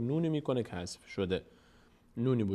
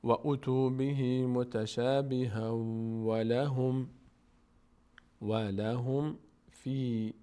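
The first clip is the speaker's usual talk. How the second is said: very slow chanted verse; Quran recitation; much slower than usual. Shown as a demonstration. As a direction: intone the verse, stretching vowels.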